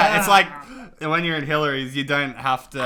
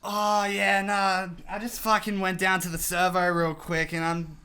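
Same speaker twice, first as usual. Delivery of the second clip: higher, casual ocker bloke voice; a hammed-up Aussie bloke impression; typical Aussie bloke; 6 semitones higher than usual. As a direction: play a laid-back Midland local. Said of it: "typical Aussie man in Midland".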